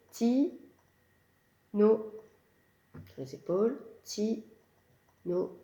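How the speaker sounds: Ti then no, each beat separate; syllables tapped out. Shown as a demonstration. Teacher shows tapping on nose and shoulders, beat by beat. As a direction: tap each syllable with a pause. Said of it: "on peut faire sur le nez".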